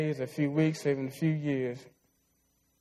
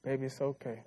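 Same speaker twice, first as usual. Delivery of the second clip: higher, gentle motherly reassurance; mother's comforting voice; 5 semitones higher than usual. Retold or imitated. Mom calming her crying son during a thunderstorm, tender. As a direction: soothe a frightened child like his mom did. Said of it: "she looked at me and said".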